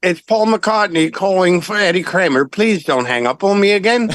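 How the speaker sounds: put-on English accent, polite plea. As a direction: imitate a British caller pleading over the phone. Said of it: "there's an English, you know, person".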